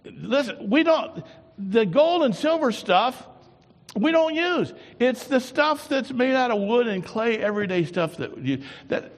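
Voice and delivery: high, loud preacher's warning; fiery preaching. Acted. Preaching at full force.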